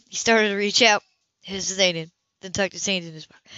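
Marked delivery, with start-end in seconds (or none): none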